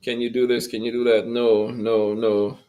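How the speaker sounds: normal talking voice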